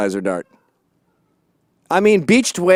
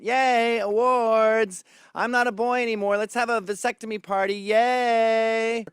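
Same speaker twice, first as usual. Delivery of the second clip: mock cheer; 7 semitones higher, 4 dB quieter than usual. Mocking men in pop culture.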